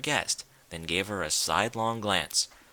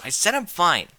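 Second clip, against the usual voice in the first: higher and louder retort; snapping; 7 semitones higher, 6 dB louder than usual. A narrator's reading. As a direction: shut the question down hard. Rainbow snaps at Rarity.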